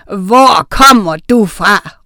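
loud, drawn-out demand; slow, loud challenge; witch's shout. A narrator's reading. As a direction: shout it slowly, word by word. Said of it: "råbte Oda".

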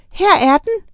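high, excited little witch shout. A narrator's reading. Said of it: "råbte en".

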